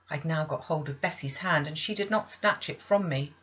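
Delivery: ordinary delivery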